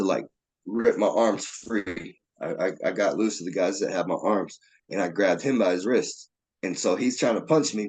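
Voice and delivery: normal talking voice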